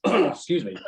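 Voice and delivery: ordinary delivery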